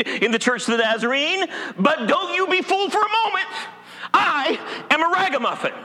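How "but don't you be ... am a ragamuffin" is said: emphatic declaration. Real, not acted. Preacher declares his identity.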